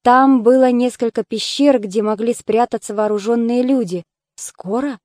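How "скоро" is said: soft, hushed narrated question; whispered question. A narrator's reading. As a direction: ask it under your breath, softly. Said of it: "прошептал Зидантос". (вопрос вполголоса, почти шёпотом)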